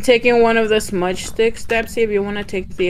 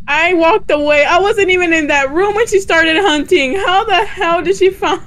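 indignant; 8 semitones higher, 4 dB louder than usual. Real, not acted. Indignant after hunt.

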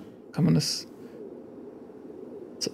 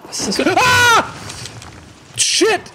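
startled scream. Real, not acted. Gamer shrieks at a sudden monster, real startled scream. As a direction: scream as something jumps out at you. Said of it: "Entschuldigung, dass ich geschrien hab".

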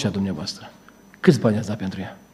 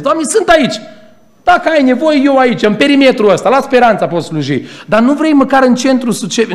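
mock prayer; 11 semitones higher, 6 dB louder than usual. Mocking a lazy believer's prayer.

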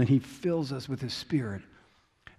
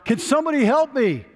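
high desperate yell from the cliff; man dangling from a branch yelling up for help; 12 semitones higher, 5 dB louder than usual. Retold or imitated. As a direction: cry out for help, pitched way up. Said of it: "so he yells out".

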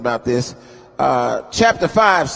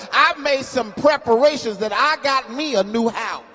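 sermon cadence, rising and intense; preaching; 6 semitones higher than usual. Acted. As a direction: lift the scripture like a preacher at the pulpit. Preaching builds.

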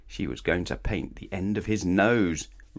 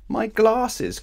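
high, loud cry of dismay; cried out; 5 dB louder than usual. Acted. Mister Clever crying out over his broken glasses. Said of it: "cried Mister Clever".

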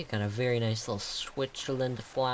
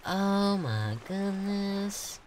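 high, drawn-out Oh my goodness; amazed; 8 semitones higher, much slower than usual. Real, not acted. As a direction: slowly savour Oh my goodness in awe. Amazed reacting to a detail.

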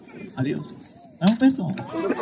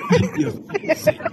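high, breathy laughing lament; mock despair; 5 dB quieter than usual. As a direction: pretend to despair while laughing. (lamento fingido entre risas, agudo)

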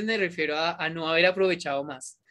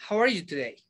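"How are you today?" run into one quick unit; linked together; 3 semitones higher, faster than usual. Shown as a demonstration. Teacher showing learners how linkers sound, all in one go. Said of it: "Digo toda de una".

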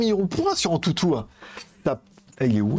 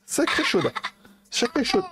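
mock teasing; 10 semitones higher than usual. Teasing the assistant's moans.

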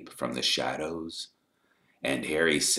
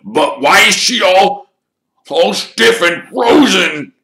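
high, loud, anguished wail; distraught; 16 dB louder than usual. A narrator's reading. Caretaker asking about his frozen cat, distraught and loud.

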